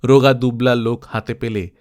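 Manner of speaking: ordinary delivery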